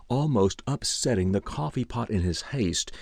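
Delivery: ordinary delivery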